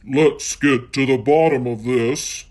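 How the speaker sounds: loud growling Daddy Bear; Daddy Bear vows to find the culprit, growling; growled. Acted. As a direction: growl it with determination. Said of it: "Daddy Bear growled".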